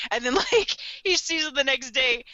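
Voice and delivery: normal talking voice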